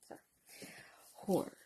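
ordinary talk, nothing marked

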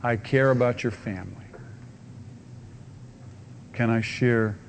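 soft, tender words to a stranger; loving invitation. Acted. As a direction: offer it gently, with care. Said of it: "with an attitude of love".

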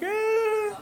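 long high mock wail; imitated child's cry. Preacher imitating his small girl starting to cry, high wail. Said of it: "she really starts crying".

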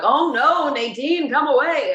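high, loud mock panic; hammed-up urgent call to get away; alarmed warning. Retelling a partner warning Nadine off a snake, mock alarm.

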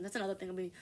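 ordinary talk

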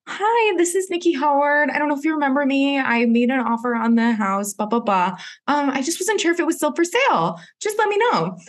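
high, sing-song fake politeness on a phone message; sugary voicemail voice. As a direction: sound overly sweet and innocent while leaving the message. Voicemail to the seller, sweet voice.

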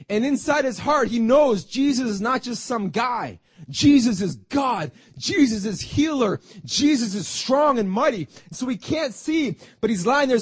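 loud, high fervent preaching. Acted. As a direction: shout the sermon with fire. Preaching at full force.

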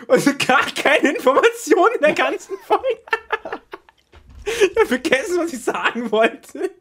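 high and loud through laughter; laughing. Real, not acted. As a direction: push the words out while cracking up. Laughing fit.

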